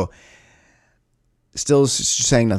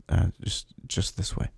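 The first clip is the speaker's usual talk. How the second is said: mumbled directions; 4 semitones lower, 9 dB quieter than usual. Retold or imitated. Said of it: "she just points forward and she goes".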